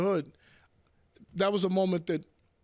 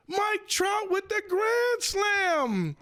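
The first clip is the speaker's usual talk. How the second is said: high, slow, stretched-out shout like a play-by-play announcer; mock radio call; 13 semitones higher, much slower than usual. Hearing the radio on the drive home, a drawn-out announcer shout. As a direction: belt it out like the radio booth calling a grand slam.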